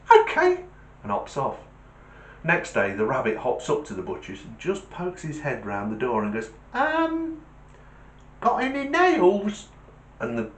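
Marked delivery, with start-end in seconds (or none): 0.1-0.6 tiny, very high bunny voice; high-pitched little rabbit reply; meek joke rabbit. Acted. 6.7-9.7 very high, hesitant bunny voice; timid high-pitched rabbit question; tentative joke rabbit. Acted.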